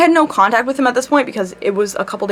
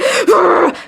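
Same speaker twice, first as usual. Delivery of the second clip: sharp gasp; 8 semitones higher, 3 dB louder than usual. Retold or imitated. Reacting to friend's advice.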